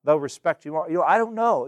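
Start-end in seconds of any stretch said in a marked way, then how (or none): 1.0-1.7 honest admission. Shown as a demonstration. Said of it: "If you don't know, just tell them".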